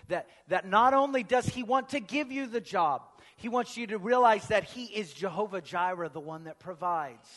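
loud, high, building sermon climax; preaching at full force. Acted. Pastor preaches to the congregation, loud and rising. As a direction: lift the room with it.

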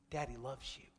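soft, low, tender reassurance; tender whisper to son. Retold or imitated. Father comforting his son during stitches, soft and tender. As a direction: soothe a hurt child close to his ear. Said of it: "all I would say were these words".

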